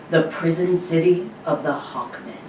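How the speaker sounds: usual speaking voice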